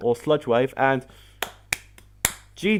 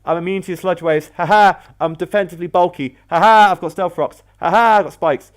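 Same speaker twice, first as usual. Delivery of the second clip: higher, louder mock gloat, punching each "ha ha"; gloating taunt; 4 semitones higher, 5 dB louder than usual. Voicing Skarmory mocking its opponent, gloating taunt. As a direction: crow it like an untouchable tank. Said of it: "just sit there and go".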